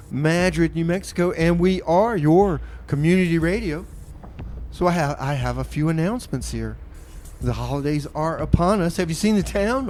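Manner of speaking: ordinary talk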